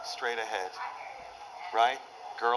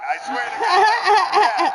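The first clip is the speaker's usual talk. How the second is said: laughing; 12 semitones higher, 10 dB louder, much slower than usual. Real, not acted. Laughing punchline tag.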